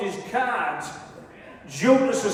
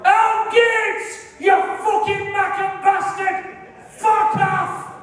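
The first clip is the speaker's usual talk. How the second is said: high football-terrace yell, "Al Gates" pitched way up; terrace shout; 11 semitones higher, much slower than usual. Retold or imitated. Fan at Ayresome Park yelling at the rival player, high terrace shout. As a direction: bellow abuse like a fan in the stands. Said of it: "And I'd always shout".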